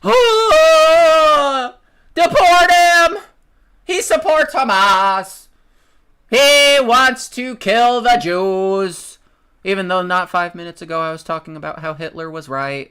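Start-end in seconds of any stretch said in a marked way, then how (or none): 0.0-9.2 high, loud, slow hysterical shrieking; mock outrage. Mocking hypocrites shrieking to deport Khalil, high hysterical yell. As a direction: shriek like a pearl-clutching hypocrite.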